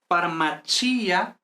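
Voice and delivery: FARMACIA very slow as far-ma-CHEE-a; stress on i. Shown as a demonstration. Teacher gives students an example, slow FARMACIA stressed on the i. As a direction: stretch the stressed i in "farmacia", Italian ch sound. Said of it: "con acento en la I".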